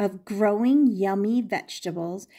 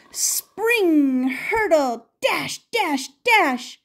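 higher and louder, bouncing action words; excited bunny dash over the fence; leaping excitement; 6 semitones higher, 3 dB louder, slower than usual. A narrator's reading. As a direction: leap over each word like a bunny.

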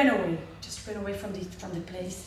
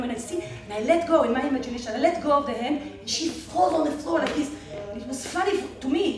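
ordinary talk

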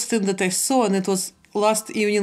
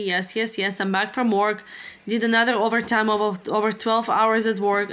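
normal talking voice

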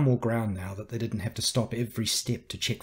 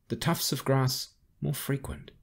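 plain reading voice

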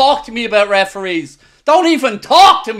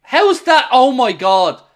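ordinary delivery

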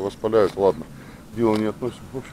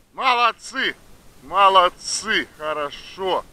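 praising the dogs; 8 semitones higher, slower than usual. Real, not acted.

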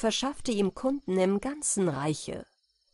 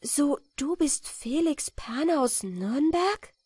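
high, slow question of recognition; dawning amazement, drawn out; moved exclamation; 8 semitones higher, slower than usual. A narrator's reading. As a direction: ask slowly, voice lifted in wonder. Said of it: "rief sie".

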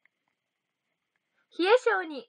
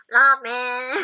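ラーメン said with the nose pinched shut, blocked nasal sound; 3 semitones lower, 11 dB louder, much slower than usual. Pretend. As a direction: hold your nose and say ラーメン. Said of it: "鼻つまんで何か一言".

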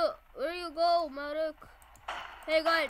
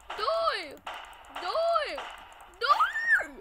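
rising battle yells, each one higher; yelling; 13 semitones higher, 5 dB louder, much slower than usual. Real, not acted. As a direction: scream "die" at the enemies. Kid attacking zombies in game.

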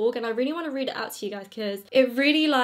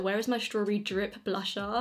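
usual speaking voice

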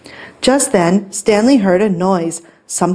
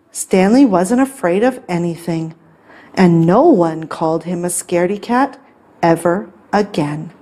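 ordinary delivery, nothing marked

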